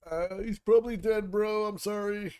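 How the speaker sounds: put-on high, loud joking bro tone to a child; mock blunt. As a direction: break bad news like a careless bro. Blunt reply to kid NPC.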